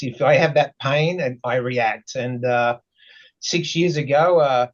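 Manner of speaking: ordinary talk, nothing marked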